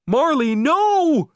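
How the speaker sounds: high, loud, slow desperate call; shouting. A narrator's reading. Owner shouting at Marley pooping, desperate loud call. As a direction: yell it long across the beach. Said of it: "I shouted".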